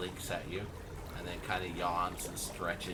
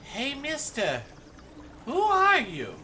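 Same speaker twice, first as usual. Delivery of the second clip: put-on very high little-kid voice, louder on the question; dwarf child; 7 dB louder than usual. Dwarf child questions a stranger, high-pitched kid voice. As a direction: pipe up high like a nosy child. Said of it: "the dwarf chi-, child says".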